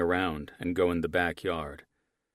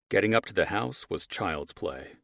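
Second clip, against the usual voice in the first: usual speaking voice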